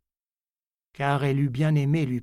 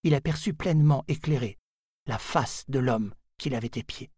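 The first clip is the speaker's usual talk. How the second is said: plain reading voice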